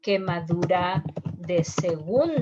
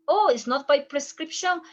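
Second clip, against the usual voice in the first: mock-surprised patient question, higher; 6 semitones higher, 4 dB louder, faster than usual. Patient asks the clinician in mock surprise. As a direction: ask like a puzzled patient.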